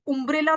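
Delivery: उम्ब्रेला starting with "oo" in place of "uh"; wrong pronunciation. Shown as a demonstration. Teacher warns class off a mistake, उम्ब्रेला begun with "oo". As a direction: begin उम्ब्रेला with a rounded "oo". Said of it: "तो नहीं बोलोगे".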